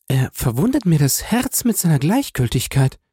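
höhere Frauenstimme, verletzt; gekränkte Klage. A narrator's reading. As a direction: dem Bruder Gleichgültigkeit vorwerfen. Julie klagt über den Bruder. (leicht gespielt klagende Julie)

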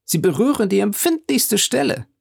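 high-pitched exclamation; theatrical dismay. Acted. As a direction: protest as if poked where it hurts. Andrea ruft, beim Geld getroffen. (theatralisch getroffen, mit hoher Stimme)

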